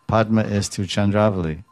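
ordinary talk, nothing marked

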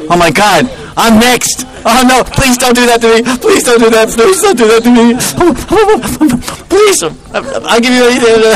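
high, frantic pleading; hammed-up begging. Krishna pleading with the gopis not to be next, high frantic begging. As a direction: beg for mercy like a cornered prankster.

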